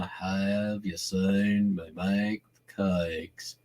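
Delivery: droopy, slow North Carolina accent. Shown as a demonstration. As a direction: drag the words through mud, North Carolina style. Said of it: "that's a little North Carolina, slower, like in mud".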